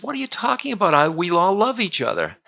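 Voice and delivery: high-pitched retold queen's disbelief; incredulous protest. Queen rebuffs her servant's dark warning, incredulous and high. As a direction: pitch leaps up, protesting the very idea.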